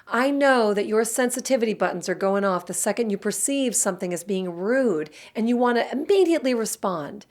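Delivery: ordinary talk, nothing marked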